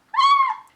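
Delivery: short, very high, loud squeal; excited squeal. Real, not acted. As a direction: let out a fangirl squeal. Reacting to the beach scene.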